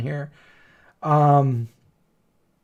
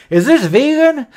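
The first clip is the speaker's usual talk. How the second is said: mock question; 10 semitones higher, 3 dB louder than usual. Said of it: "didn't feel like saying".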